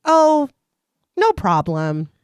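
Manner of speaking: light villain voice, casual; simple offhand reply. A narrator's reading. Leprechaun after killing the wife, lightly voiced casual. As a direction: shrug it off like nothing happened. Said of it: "He decides simply".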